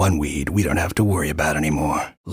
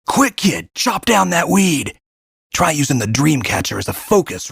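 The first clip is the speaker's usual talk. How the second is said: urgent shout; 9 semitones higher than usual. Acted. Guide urging the kid mid-fight, shouting. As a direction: yell at the kid to strike now.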